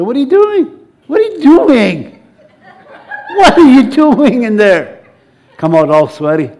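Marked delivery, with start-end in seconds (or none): 0.0-0.9 high-pitched, puzzled mock challenge; startled questioning. Someone catches you rejoicing in your room, startled question. 1.1-5.0 high, slow, stretched-out question; building to a louder, hammed-up call through the door; mock alarmed demand. Someone outside your room calls in, mock alarmed demand. Acted person demanding answer, escalating.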